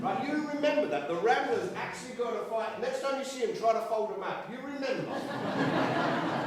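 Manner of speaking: high, loud, joking aside; excited joking aside. Real, not acted. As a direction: tease the audience with glee.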